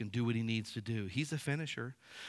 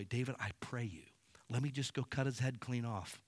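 urgent unvoiced whisper; eager whisper; 7 dB quieter than usual. Abishai begging David for permission, whispered. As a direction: lean in and whisper the request. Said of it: "and he's like".

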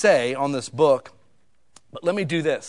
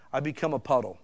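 ordinary talk, nothing marked

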